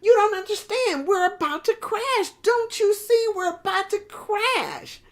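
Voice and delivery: high, pleading panic; panicking wave. Acted. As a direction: beg them to see the crash coming. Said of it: "he's saying".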